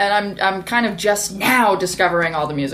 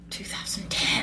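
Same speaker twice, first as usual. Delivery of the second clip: whispered punchline, breath only; 11 dB quieter than usual. Real, not acted. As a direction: drop to a secret whisper. Mocking dated podcast.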